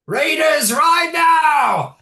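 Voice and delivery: high, loud shouted battle cry; war cry. Acted. Goblin cry translated for the party, high shouted command. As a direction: yell the order to charge. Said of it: "which essentially means".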